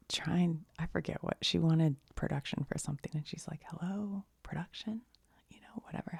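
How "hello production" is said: high, soft questioning call; small, higher-pitched "anyone there?" call; calling out tentatively. Retold or imitated. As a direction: softly call out to see who's there. Retells woman calling for production crew.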